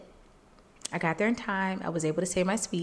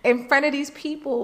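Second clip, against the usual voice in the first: crying; 6 semitones higher, 7 dB louder, slower than usual. Retold or imitated. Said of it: "I am crying".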